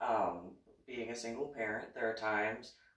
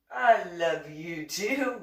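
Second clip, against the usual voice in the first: slow, stretched and louder; drawn-out loving reply; 6 semitones higher, 8 dB louder, much slower than usual. Retold or imitated. Mom answering her son's sudden I love you, drawn out. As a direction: stretch it out with exaggerated affection.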